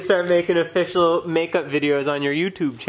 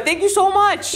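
excited; 14 semitones higher than usual. Real, not acted. Gushing thanks to fan.

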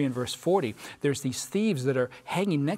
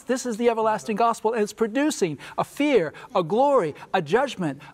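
high, emphatic pulpit summation; fervent preaching climax; 5 semitones higher than usual. Real, not acted. Preacher sums up the everlasting gospel for his panel, fervent preaching. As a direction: drive each point home like a preacher.

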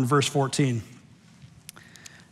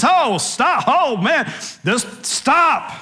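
indignant pastor; 8 semitones higher, much slower than usual. Retold or imitated. Preacher mimics the pastor stopping the service, exasperated. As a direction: play the fussy senior pastor halting the service. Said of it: "He stops the service".

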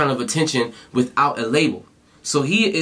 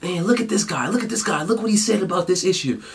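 mocking hater voice; 5 semitones higher than usual. Mimicking hater comments.

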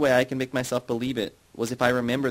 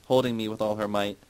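ordinary delivery, nothing marked

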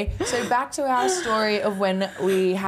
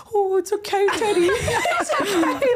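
much higher mock soothing coo; soothing the dog; 11 semitones higher than usual. Keely in the boot calming excited Teddy, high coo. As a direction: pat the dog with your voice, high and cooing. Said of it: "Keely's in the back going".

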